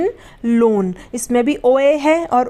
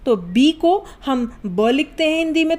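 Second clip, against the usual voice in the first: ordinary talk, nothing marked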